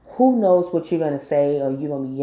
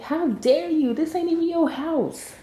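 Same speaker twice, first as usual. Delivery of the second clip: high-pitched indignant outburst; retold outrage, pitched way up at normal volume; mad; 11 semitones higher than usual. Viewer scolding a guest in someone else's house, mad. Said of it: "I was so mad looking at it like".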